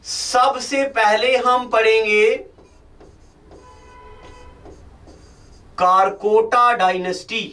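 slow, spaced-out teacher dictation. Acted. Teacher dictating notes slowly to camera. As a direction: read it out slowly so they can write.

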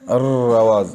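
अर्र with the R rolled on and on; trilled R. Shown as a demonstration. Teacher explains takrir to students, R trilled in अर्र. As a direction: let the R of अर्र rattle in a long trill. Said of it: "तकरीर क्या चीज़ है?".

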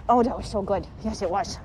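hushed, low praise for a good dog; quiet doting praise; soft praise. Real, not acted. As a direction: coo it softly like a proud owner.

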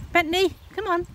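high coaxing call; calling the dog. Real, not acted. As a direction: urge the dog along.

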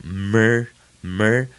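mer with lips pressed firmly before release; tight-lipped M. Shown as a demonstration. Teacher drilling the syllable, mer with tightly closed lips. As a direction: press the lips shut, then a full mer. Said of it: "两个嘴唇闭得很紧".

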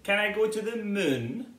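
a little cub asking eagerly; Baby Bear. Acted. Little bear asking mum about the moon, eager.

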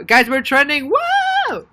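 loud, high whoop of joy; excited cheer. Real, not acted. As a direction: cheer like you just went viral. Trending on the livestream.

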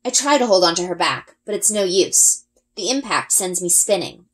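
ordinary delivery

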